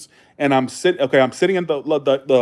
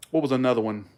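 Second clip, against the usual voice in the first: normal talking voice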